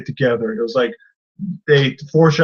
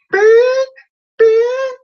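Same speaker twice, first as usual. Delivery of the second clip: high, loud, held beep; sustained one-note beep; imitated censor beep; 6 dB louder than usual. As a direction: bleep yourself out in a high tone.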